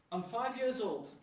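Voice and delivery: ordinary delivery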